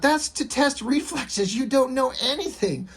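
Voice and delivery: put-on exasperated girl. Susie freaking out at Calvin.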